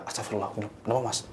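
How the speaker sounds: quiet, steady exclamation; calm, not screaming. Retold or imitated. Driver Bambang asking calmly what is wrong, quiet and steady. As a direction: keep it level while others panic. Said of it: "Mas Bambang nggak teriak".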